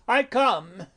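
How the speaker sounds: high, loud call to the door. Acted. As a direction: call it out to someone outside. Friar calls to knocker.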